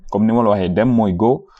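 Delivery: ordinary talk, nothing marked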